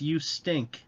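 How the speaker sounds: a hint of a squeaky taunting elf. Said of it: "Elf says in a squeaky voice".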